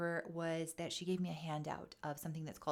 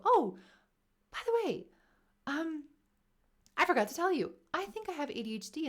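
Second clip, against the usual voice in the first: higher, louder sudden confession; sudden blurt; 11 semitones higher, 6 dB louder than usual. Retold or imitated. Telling the therapist at the very end, high and sudden. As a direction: blurt it out as if you just remembered.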